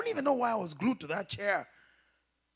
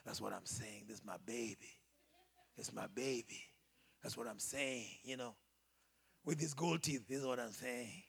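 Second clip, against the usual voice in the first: mocking the boyfriend; 11 semitones lower, 9 dB quieter than usual. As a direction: sneer it like the smug man with gold teeth. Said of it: "You know the way they say".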